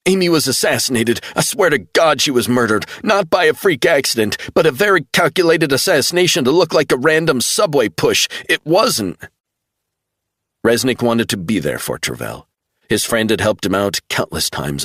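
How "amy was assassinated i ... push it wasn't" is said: much higher, urgent pleading; desperate insistence. A narrator's reading. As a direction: make him believe you. Travell insisting.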